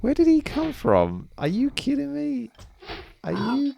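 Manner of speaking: high, incredulous squeal at the ambush; can't believe the enemy just appeared; shocked disbelief. Real, not acted. Gamer yelps at the enemy popping out of nowhere, incredulous.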